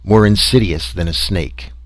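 plain reading voice

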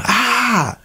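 high-pitched mock scream; short, high shriek imitating a frightened woman; imitated scream. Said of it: "I heard her scream".

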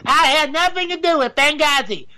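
a rough stab at a political impression; Hillary Clinton. Shown as a demonstration. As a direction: deny it like a cornered candidate. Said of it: "I can't do Hillary Clinton".

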